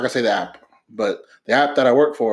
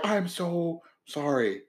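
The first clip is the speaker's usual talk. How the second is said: meek apology; 3 semitones higher, 10 dB quieter, much slower than usual. Retold or imitated. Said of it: "she went from".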